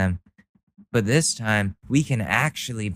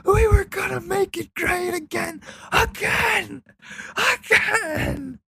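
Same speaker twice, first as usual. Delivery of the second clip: high, wailing sob-voice of a crying driver; hammed-up high-pitched crying; mock crying. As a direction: blubber it out like a sobbing fan. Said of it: "just crying like".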